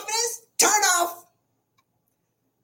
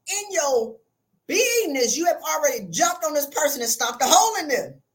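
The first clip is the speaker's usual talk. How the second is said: ordinary talk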